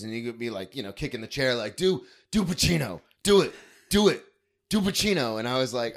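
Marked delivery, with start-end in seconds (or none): 1.8-5.4 imitating a tipsy dad, high and loud; tipsy dad egging on. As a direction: loudly goad the kid to perform, as Dad. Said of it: "my dad would have a couple of drinks".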